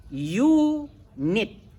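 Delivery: "Unit" said as yunit; "Unit" with a yu sound at the start, rising; English pronunciation. Shown as a demonstration. Scene: teacher reading unit aloud for his class. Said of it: "U di situ U pula bacanya".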